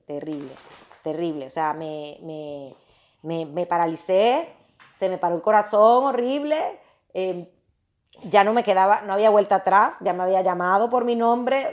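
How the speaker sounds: usual speaking voice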